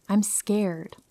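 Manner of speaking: ordinary delivery, nothing marked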